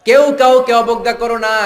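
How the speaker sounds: high, sustained sermon cry; ringing, high-pitched preacher's delivery; full-force preaching. Real, not acted. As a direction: proclaim the warning high and hard.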